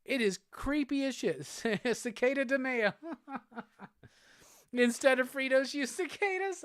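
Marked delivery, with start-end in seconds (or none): 1.5-4.7 laughing through the joke. Real, not acted. 4.7-6.6 disbelieving question, pitched way up; can't believe it, high rising; incredulous. Real, not acted. Incredulous at chat suggestion.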